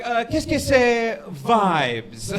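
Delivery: put-on slow, high, loud pretentious French accent; hammed-up French accent; mock French accent. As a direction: savour it like a French phrase. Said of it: "You can't be like".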